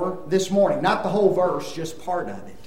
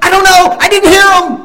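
exasperated reply; 11 semitones higher, 13 dB louder, faster than usual. Retold or imitated. His reply in retold dialogue.

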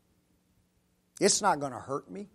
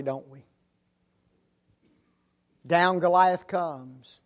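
normal talking voice

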